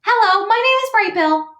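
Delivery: very high, loud chick voice; little gosling voice. Acted. Gosling greets anyone who will listen, high little voice. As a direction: introduce yourself as a proud baby bird. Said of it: "becoming a great little speaker".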